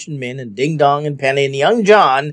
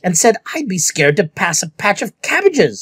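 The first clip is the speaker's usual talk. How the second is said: venomously; 6 semitones higher than usual. A narrator's reading. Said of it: "said Caraway venomously".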